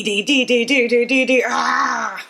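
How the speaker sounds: mock obnoxious loud chant; mock loud playing. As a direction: imitate someone blaring every note. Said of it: "really loud".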